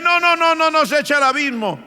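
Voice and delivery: terrified demons begging Jesus; frantic begging. Retold or imitated. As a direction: plead desperately not to be sent away. Demons beg not to be cast out.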